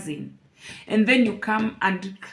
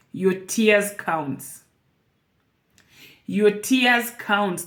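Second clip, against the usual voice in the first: slow and deliberate; much slower than usual. Real, not acted. Slow emphatic repeated advice.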